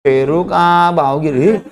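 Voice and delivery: slow, stretched-out street cry; hawking vendor. Retold or imitated. Seller repeats the guava price drop, slow drawn-out hawking. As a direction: draw each word out like a hawker's chant. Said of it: "जोर जोर से चिल्ला रहा है".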